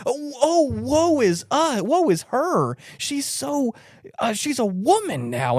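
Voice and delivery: high, hammed-up wailing; mock woeful lament. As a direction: moan it like a melodrama heroine. Acted lament over the woman.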